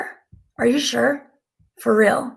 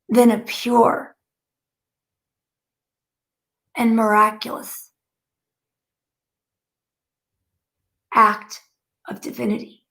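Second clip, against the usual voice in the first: channeled message. Acted. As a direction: deliver it like solemn teaching, word by word. Relays a spirit's message.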